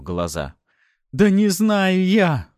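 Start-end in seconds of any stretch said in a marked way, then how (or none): 1.1-2.5 high, loud, drawn-out yell; desperate agonized shout; screamed denial. A narrator's reading. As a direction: scream it through the pain. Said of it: "кричал он".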